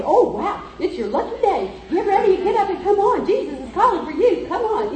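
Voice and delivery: excited, high-swinging cheering on; happy crowd. Acted. Crowd urges blind man to Jesus, excitedly happy. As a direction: gush and hurry him along. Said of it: "Then everybody's happy".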